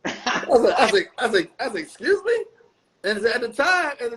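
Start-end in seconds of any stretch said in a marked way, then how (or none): 1.8-2.4 high-pitched incredulous question; retold "say what?" disbelief, pitched way up; taken aback. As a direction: act out being thrown and nearly offended. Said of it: "I almost got offended".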